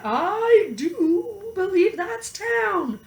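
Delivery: high, slow cow voice; cow uttering. A narrator's reading. Molly the cow to Mabel, high and slow. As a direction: cow spotting the town ahead. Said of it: "Molly uttered".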